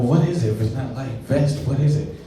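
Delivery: ordinary delivery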